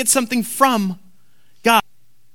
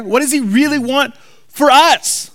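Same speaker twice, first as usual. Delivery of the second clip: ordinary delivery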